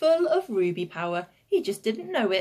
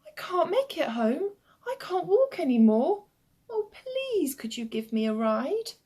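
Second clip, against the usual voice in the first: high, plaintive child's plea, mock tearful; crying small boy; 8 semitones higher than usual. A narrator's reading. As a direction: whine pitifully, swooping high on "please". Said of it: "and he cried".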